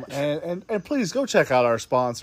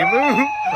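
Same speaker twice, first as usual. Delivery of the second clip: yodeling. Acted. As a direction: whoop up high like a yodeler. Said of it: "You started yodeling".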